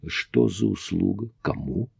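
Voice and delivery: хрипло и тихо, пересохшим горлом; выдавить хрипло, с трудом; hoarse. A narrator's reading. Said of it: "с большим трудом хрипло проговорил". (хрипло)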